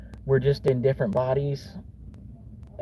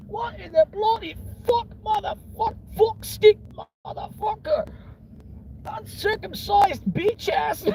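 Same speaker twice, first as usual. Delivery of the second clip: very high squeaky voice with slow curses; mock British cursing tirade; slower than usual. UK stoner cursing spree.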